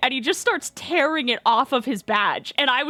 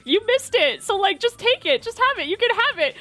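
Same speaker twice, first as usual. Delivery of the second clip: high, insistent retold urging; re-enacted eager insistence; imitating the director; 8 semitones higher than usual. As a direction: brush off protests in a raised pitch. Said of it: "He's like".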